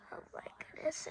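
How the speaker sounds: breathy whispered aside; hushed little whisper. Real, not acted. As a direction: whisper it like a secret to the viewers. Aside about time running out.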